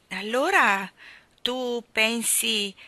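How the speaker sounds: slow, hesitant opening with pauses; embarrassed. A narrator's reading. Michael fears what Jane means, starting out embarrassed. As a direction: feel your way awkwardly into the question. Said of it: "cominciò imbarazzato".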